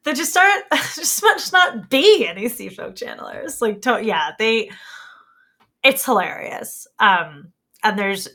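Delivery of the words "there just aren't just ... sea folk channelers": put-on high giggly Valley Girl voice; bubbly Valley Girl, laughing through it; Valley Girl accent. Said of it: "with the same Valley Girl accent".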